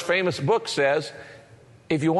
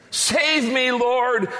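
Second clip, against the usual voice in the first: shouted plea; 6 semitones higher, much slower than usual. A narrator's reading. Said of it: "he shouted".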